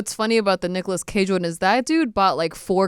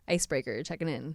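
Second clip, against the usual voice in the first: quiet radio call-in; over walkie talkie; 3 semitones lower, 11 dB quieter than usual. Pretend.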